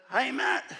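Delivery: high, bright phone greeting as Ray; a high-pitched mate on the phone; Ray's voice. Retold or imitated. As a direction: call out cheerily as an old mate. Ray calls out of the blue.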